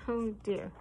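usual speaking voice

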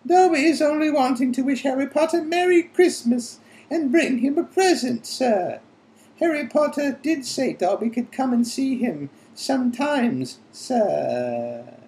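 very high, squeaky elf voice; Dobby. A narrator's reading. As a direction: squeak it eagerly like an anxious little elf. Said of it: "squeaked Dobby anxiously".

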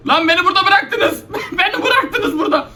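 tiz, gülerek sitem; gülerek yakınma. Real, not acted. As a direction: kahkahalar arasında serzeniş. Kovalanırken arkadaşları kaçmış. (arkadaşlarına gülerek sitem eden oyuncu)

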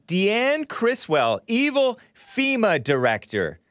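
name her like a movie villain; ominous slow. Acted.

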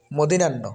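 মদিনান্ন as mo-di-nan-no; common mispronunciation. Shown as a demonstration. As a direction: run মদিনান্ন together with di-nan. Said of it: "কিন্তু সঠিক".

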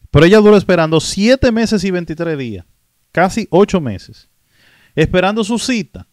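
loud, emphatic amazement at the figures. Real, not acted. Host stressing a migrant's long wait to viewers, loud and emphatic.

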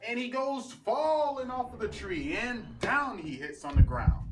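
loud, high, drawn-out storytelling; dramatic climax. A narrator's reading. Storyteller as he falls off the tree, loud dramatic climax. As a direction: raise the voice and stretch out the fall.

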